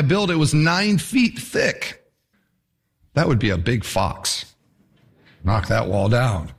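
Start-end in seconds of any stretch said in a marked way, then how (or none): none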